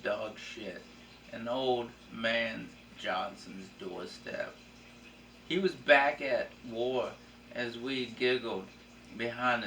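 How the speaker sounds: slow, soft poetry recital; poem reading. Shown as a demonstration. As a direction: recite the poem slowly, softly. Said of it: "This is called In My Youth".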